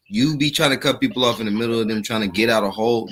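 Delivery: ordinary talk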